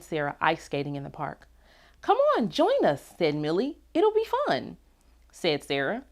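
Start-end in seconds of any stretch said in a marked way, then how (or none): 2.0-3.1 high, eager invitation; a high sing-out to join in; friendly calling out. A narrator's reading. Friends calling her to skate. 3.9-4.8 high-pitched cheerful urging; playful, lifted invitation; eager coaxing. A narrator's reading. Friend coaxing her to skate.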